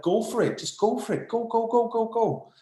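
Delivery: high, eager mock cheer of a crowd; excited cheering. Channeler voicing Lemurians cheering humanity on, high excited chant. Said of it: "They're like".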